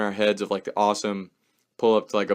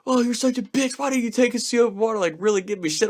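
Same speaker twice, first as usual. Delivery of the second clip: high mocking taunt, rushed; teasing friend; 11 semitones higher, faster than usual. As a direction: rib him for chickening out. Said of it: "Tex is like".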